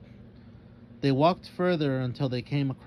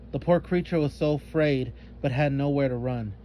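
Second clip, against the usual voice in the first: plain reading voice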